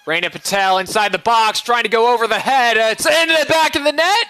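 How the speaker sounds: rising excitement as the ball goes in; building goal call. Real, not acted.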